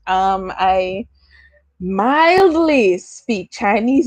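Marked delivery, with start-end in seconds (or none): 1.8-4.1 "mildly" drawn out slowly and raised; stress on mildly. Real, not acted. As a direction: lean long and slow on "mildly". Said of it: "I say mildly".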